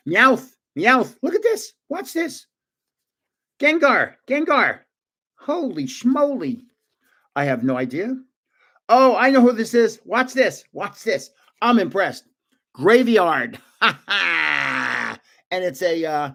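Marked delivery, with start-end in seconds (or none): 0.1-6.6 high-pitched, thrilled exclamations; excited amazement. Real, not acted. Thrilled by the cards shown. 8.9-13.6 higher, eager bursts; excited recognition. Real, not acted. Proudly recognizes a card. 13.8-15.1 long, drawn-out cackle; triumphant laugh. Real, not acted. Delighted at naming the card.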